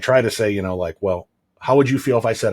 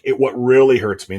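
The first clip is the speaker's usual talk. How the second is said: ordinary talk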